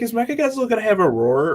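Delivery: ordinary delivery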